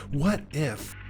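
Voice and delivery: ordinary delivery, nothing marked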